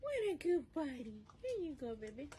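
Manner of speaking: pet talk. Real, not acted. Cooing at dog eating.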